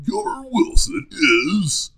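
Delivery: slow, high, loud trailer announcer; trailer guy voice. Shown as a demonstration. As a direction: stretch it out like a movie trailer. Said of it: "my trailer guy voice".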